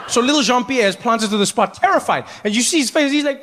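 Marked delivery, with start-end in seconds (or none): none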